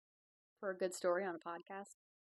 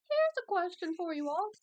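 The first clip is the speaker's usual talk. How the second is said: loud, much higher sustained voice; high-pitched announcement; 12 semitones higher, 9 dB louder than usual. Real, not acted. Podcaster repeats the question to listeners, high and loud. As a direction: pitch it way up and call it out. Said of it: "Sorry if I just spiked the audio".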